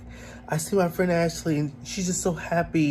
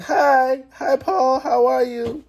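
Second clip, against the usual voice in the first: high, loud sing-song video-call hello; imitating parents' greeting; 10 semitones higher, 4 dB louder, much slower than usual. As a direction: mimic delighted parents calling out on Zoom.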